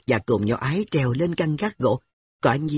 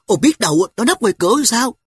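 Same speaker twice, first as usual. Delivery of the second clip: put-on high, loud anxious girl's question; a little girl fretting about the door; worried; 10 semitones higher, 7 dB louder than usual. Sister to brother, high worried girl voice. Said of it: "Nhỏ Ái lo lắng".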